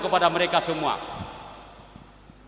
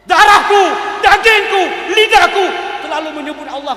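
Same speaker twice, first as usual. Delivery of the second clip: far higher and louder, fervent protest; indignant outburst; 12 semitones higher, 13 dB louder than usual. Retold or imitated. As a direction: cry out Darahku dagingku lidahku in outrage. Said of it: "Dia marah". (protes marah bernada tinggi)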